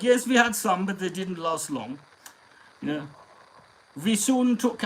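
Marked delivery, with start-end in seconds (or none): 0.0-2.0 put-on light German accent, raised start. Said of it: "in a German accent". 4.0-4.8 put-on German accent. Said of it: "in a German accent".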